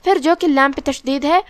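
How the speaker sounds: ordinary delivery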